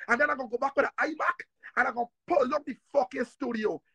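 ordinary talk, nothing marked

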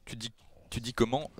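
normal talking voice

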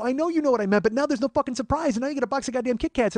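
high-pitched frustrated complaint; exasperated protest. Retold or imitated. Man telling her the surprise is ruined, exasperated.

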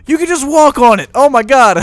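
loud, high, gasping amazement; amazed gasp. Real, not acted. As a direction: blurt it out in sheer wonder. Amazed at walking on water.

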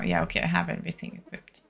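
quick whisper to herself; whispered mutter. Real, not acted. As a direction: mutter it under your breath, fast. Muttering to self while checking gear.

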